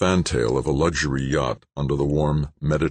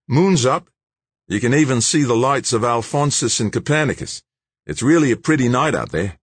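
higher and brighter, a big Aussie bloke talking up the night; young Aussie George; 9 semitones higher, 4 dB louder than usual. Acted. George's voice in the story.

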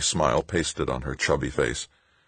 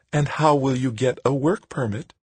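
high-pitched leading question; patronizing schoolteacher; 9 semitones higher than usual. A narrator's reading. Woman cornering Tavalera over permits, patronizing schoolteacher. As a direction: ask it like a condescending schoolteacher. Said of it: "back to her patronizing schoolteacher tone".

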